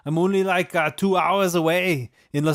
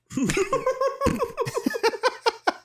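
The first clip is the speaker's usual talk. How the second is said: big high laugh; 4 dB quieter than usual. Real, not acted. Host bursts out laughing after his own Southern read, high-pitched.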